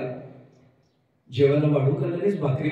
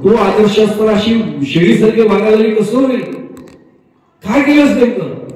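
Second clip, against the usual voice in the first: much louder, higher scolding; scolding friend; 3 semitones higher, 10 dB louder than usual. Retold or imitated. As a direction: scold her friend for acting meek.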